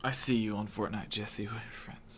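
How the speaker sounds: soft, breathy ASMR whisper. Pretend. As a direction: whisper it close to the mic. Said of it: "A S M R Jesse".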